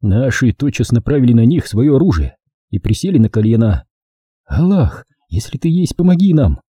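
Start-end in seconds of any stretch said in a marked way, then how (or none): 4.4-6.6 higher-pitched, mildly fervent plea; горячая мольба. A narrator's reading. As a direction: plead for divine help with a touch of urgency. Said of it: "горячо взмолилась я". (мольба повышенным голосом)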